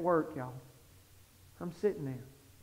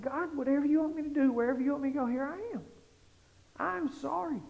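higher, softening plea; retold begging prayer; 5 semitones higher, 4 dB quieter, faster than usual. Preacher retelling his surrender to God, hushed begging plea. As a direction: act out begging, growing quieter to the end. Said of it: "I just begged God".

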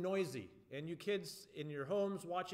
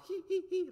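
much higher mouse-like giggle; 13 semitones higher, 5 dB louder than usual. Shown as a demonstration. Leader showing the kids a mouse giggle, tittering. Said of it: "like we're giggling".

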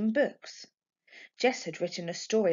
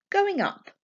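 high, curt, cheeky reply; pert child voice; 12 semitones higher, 5 dB louder than usual. Acted. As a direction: toss it off with childish sass. Jess answering the staring woman.

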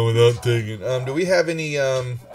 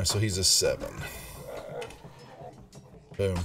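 usual speaking voice

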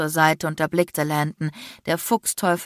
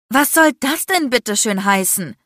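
higher, louder, indignant retort; yelling back; 5 semitones higher, 5 dB louder than usual. A narrator's reading. Woman fires back at her accuser, yelling. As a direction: demand an explanation, outraged. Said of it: "brüllte ich zurück".

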